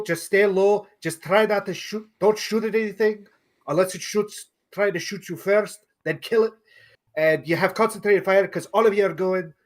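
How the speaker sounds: put-on officer briefing troops in a faint Scottish accent. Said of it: "my Scottish accent".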